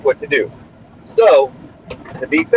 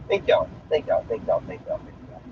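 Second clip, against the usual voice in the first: repeated thanks trailing into a whisper; thanks fading away to nothing; 12 dB quieter than usual. Real, not acted. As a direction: let each thank-you fade softer and softer.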